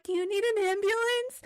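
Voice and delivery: high-pitched dazed question, imitating oneself in shock; retold distraught. Crash victim on the phone with police, imitated as high-pitched distraught. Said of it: "That's how distraught I was".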